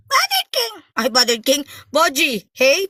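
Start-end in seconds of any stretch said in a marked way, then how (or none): 0.1-0.7 very high, squeezed call; pinched squeaky voice. Pretend. Host hailing a viewer by nickname, pinched squeaky voice. Squeaky take, then usual voice.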